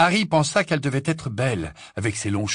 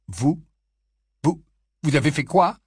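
stunned; 5 semitones higher, 4 dB louder than usual. A narrator's reading. Harry stunned.

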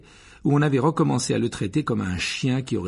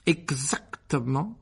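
slow, drawn-out, menacing approval; nasty tone; 5 semitones higher, much slower than usual. A narrator's reading. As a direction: stretch EXACTEMENT out with malice. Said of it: "d'un ton mauvais". (ton mauvais, lent et appuyé)

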